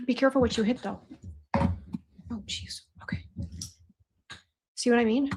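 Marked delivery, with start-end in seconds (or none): none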